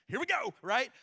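high, loud, wired burst; jittery caffeinated kickoff; too much caffeine. Real, not acted. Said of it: "I've had, like, three cups of coffee".

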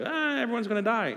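high-pitched, soft mock wail; hammed-up doom in a high voice; mock panic. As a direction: imitate a panicking English teacher. English teacher voiced in panic.

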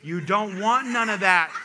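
big, drawn-out mock warning; loud retold warning. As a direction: drive the warning home, word by word. Same warning repeated loudly to audience.

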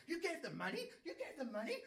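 high, loud incredulous yell; mock screaming boyfriend; retold screaming. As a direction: shriek it in disbelief. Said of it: "And started screaming".

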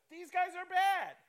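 high, loud yell at the villains; loud, high-pitched mock outrage; indignant yelling. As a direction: yell it as if scolding the book. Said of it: "Why are you yelling at your Bible?".

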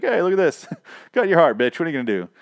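high, playful gloating; mock taunt. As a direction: tease as a lightly acted villain. Acting out the villain's gloating taunt.

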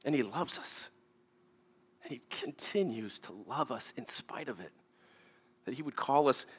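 very soft, low, with long pauses; hushed, reverent preaching. Real, not acted. Preacher telling congregation of God's love, hushed. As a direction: let it fall to an awed hush.

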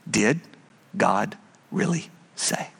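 low, soft, sinister tempter; Satan's question. Acted. Preacher voicing Satan to Adam and Eve, low and sly. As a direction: whisper the doubt like the serpent.

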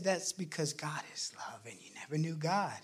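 soft unvoiced whisper. Voices whispering to him that God is love. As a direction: breathe it like a secret.

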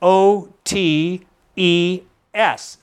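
slowly spelling out L I T O T E S letter by letter; spelled out. Shown as a demonstration. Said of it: "It's spelled".

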